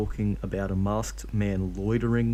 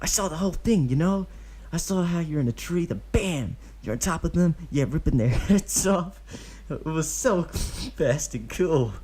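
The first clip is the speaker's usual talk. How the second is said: put-on high, gushing kid fanboying; excited boy voice; 8 semitones higher than usual. A narrator's reading. Kid gushing to Obito about the kill, excited. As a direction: rave about the fight like a fan.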